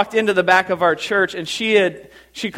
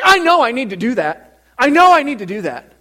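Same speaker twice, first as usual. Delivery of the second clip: loud, high, emphatic parishioner; Teresa impression; 7 semitones higher, 6 dB louder than usual. Churchgoer admitting her need to pastor, loud high impression. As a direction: insist loudly as an outspoken gal. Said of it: "That's exactly how she talks".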